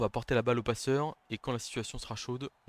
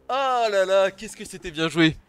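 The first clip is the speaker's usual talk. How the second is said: high, loud cry of dismay; loud, pitched-up regret; loud lament; 8 semitones higher, 12 dB louder, slower than usual. Real, not acted. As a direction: lament a great play gone wrong. Lamenting the failed play.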